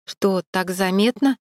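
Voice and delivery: ordinary delivery, nothing marked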